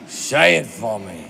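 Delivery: low, slow coaxing; hammed-up movie star drawing out a request; Al Pacino. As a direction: coax slowly in a low voice. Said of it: "Al Pacino says".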